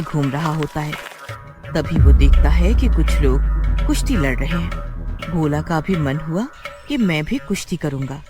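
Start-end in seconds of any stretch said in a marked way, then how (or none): none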